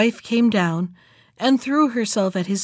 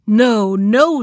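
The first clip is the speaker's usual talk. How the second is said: louder, emphatic refusal; stern refusal; 7 dB louder than usual. A narrator's reading. Bluebeard denying his wife a moment, stern refusal. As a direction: refuse her firmly and loudly.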